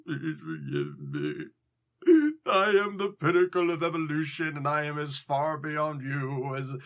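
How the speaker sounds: lightly voiced begging villain, slowed; groveling Apocalypse. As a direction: plead for mercy in a put-on voice.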